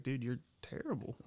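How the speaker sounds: calm retold jab. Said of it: "I was just like".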